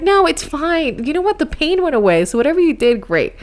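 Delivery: high-pitched stubborn insistence that the pain is gone; high arguing denial. Retold or imitated. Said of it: "I'm still arguing with him".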